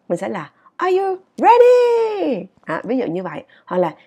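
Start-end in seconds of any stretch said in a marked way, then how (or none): 0.8-2.4 high, loud, drawn-out "ready"; rousing call. Shown as a demonstration. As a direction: call it out to pump up a crowd, stretching "ready". Calling out a readiness check. (hô to đầy hào hứng, ngân dài)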